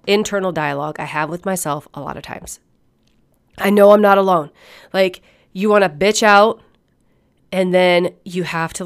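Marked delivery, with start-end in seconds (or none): none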